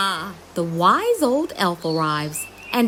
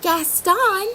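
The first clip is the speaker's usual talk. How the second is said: calling out; 12 semitones higher than usual. Acted. Ben calls the ladybird.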